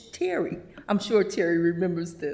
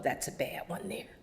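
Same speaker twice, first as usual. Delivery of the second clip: whispered, much softer warning; conspiratorial whisper; 4 semitones lower, 11 dB quieter than usual. Retold or imitated. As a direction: whisper it like a secret. Sherry warning about a person.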